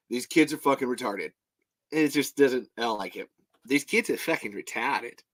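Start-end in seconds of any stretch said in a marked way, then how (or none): 3.7-5.2 understated put-on Aussie accent; Australian accent. Shown as a demonstration. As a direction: say it lightly in Australian. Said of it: "However, the Australian accent".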